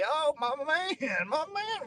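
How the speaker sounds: high, drawn-out "my man" greeting; excited greeting. Real, not acted. Streamer greets a viewer from Cali, excited. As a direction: greet a buddy with pitch soaring, volume held back.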